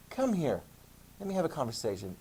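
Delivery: soft, ominous summons; a hint of a quietly menacing pope; mock stern pope. Teacher voices pope calling a critic in, soft and ominous. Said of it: "sometimes the Pope's like".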